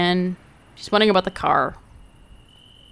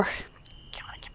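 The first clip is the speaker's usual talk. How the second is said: whispered; 21 dB quieter, slower than usual. Acted. Exhausted after release.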